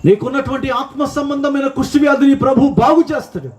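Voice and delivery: rising preacher's cry; fervent preaching peak. Real, not acted. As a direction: proclaim healing with full fervour.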